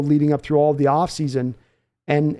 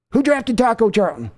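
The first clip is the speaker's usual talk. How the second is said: needling demand; 6 semitones higher than usual. Acted. Father grilling his son about a bad draft pick, sharp demand. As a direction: hit "Who" hard and high.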